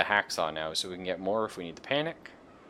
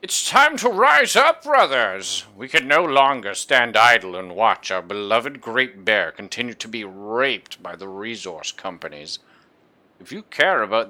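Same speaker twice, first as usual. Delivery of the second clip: fiery revolutionary speech; 11 dB louder than usual. Acted. Reading rebel note aloud, rousing.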